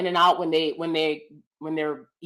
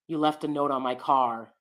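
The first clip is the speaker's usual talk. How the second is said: lightly imitated neutral American accent, lower and softer; neutral American speech; 5 semitones lower, 5 dB quieter than usual. Quoting an actress's movie line, neutral American accent. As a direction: quote it in newscaster-style neutral American. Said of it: "it's very neutral American speech".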